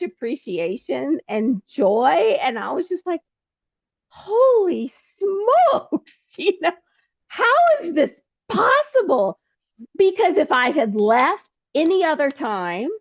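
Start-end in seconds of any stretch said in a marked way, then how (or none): 4.1-9.4 high, slow disbelieving laugh; amazed laugh. Retold or imitated. Recalling meeting her podcast host's guest, high laughing amazement. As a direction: marvel at the coincidence through a laugh.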